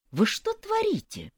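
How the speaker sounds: high-pitched indignant question. A narrator's reading. Bride to the wrecking in-laws, indignant. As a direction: protest in outraged disbelief, without shouting. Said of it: "возмутилась я".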